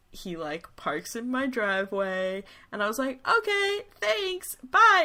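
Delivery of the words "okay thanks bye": very high, chirpy brush-off; awkward hasty goodbye. Retold or imitated. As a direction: squeak out a nervous mock farewell. Retold hasty goodbye to date.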